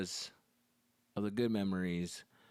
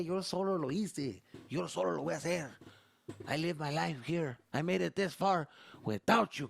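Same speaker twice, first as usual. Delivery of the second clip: much higher stubborn Mexican dad; proud Mexican dad; 6 semitones higher, 4 dB louder than usual. Retold or imitated. Dad bragging he needs no help, high and stubborn. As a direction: boast of doing it alone. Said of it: "they wanna say".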